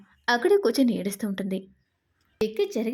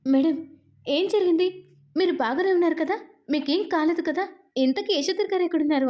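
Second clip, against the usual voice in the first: worried, much higher-pitched questions; anxious daughter-in-law on the phone, high and alarmed; panicked; 6 semitones higher than usual. A narrator's reading. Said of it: "Padmavati కంగారు పడుతూ".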